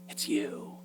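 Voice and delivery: hushed, intimate murmur; seductive whisper. Acted. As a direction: breathe it softly to make them feel special. Replaying the seductress's flattering line.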